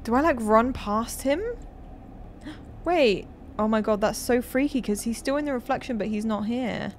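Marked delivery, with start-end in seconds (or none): 1.5-2.8 sharp frightened gasp. Real, not acted. Sudden fright.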